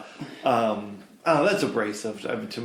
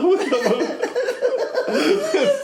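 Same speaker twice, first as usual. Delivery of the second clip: high laughing; much slower than usual. Real, not acted. Laughing falsetto continuation.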